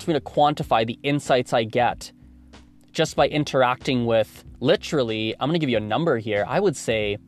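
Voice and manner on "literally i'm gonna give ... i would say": ordinary delivery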